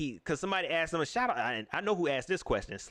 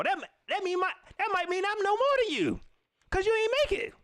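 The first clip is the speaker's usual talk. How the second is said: heated rant; 10 semitones higher than usual. Real, not acted. Ranting at fans who never played, climbing high. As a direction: build it up, outraged.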